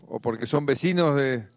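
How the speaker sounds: normal talking voice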